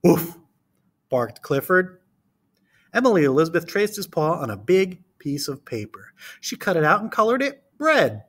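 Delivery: put-on reading to children. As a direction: read it to a class of small kids. Reads picture book aloud.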